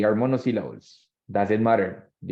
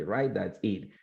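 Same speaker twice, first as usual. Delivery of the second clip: usual speaking voice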